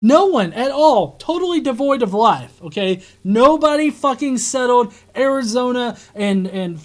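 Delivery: loud furious rant, yelling at the screen; angry shouting. Real, not acted. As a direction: shout it out in disbelief.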